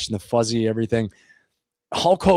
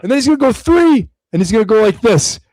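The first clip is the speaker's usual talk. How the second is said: put-on older brother; 10 semitones higher, 6 dB louder than usual. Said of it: "all of a sudden he goes".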